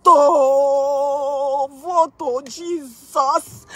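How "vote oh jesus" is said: ordinary talk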